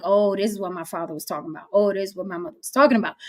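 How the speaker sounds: soft, quick realization. Retold or imitated. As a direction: murmur it like a late epiphany. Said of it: "we're like".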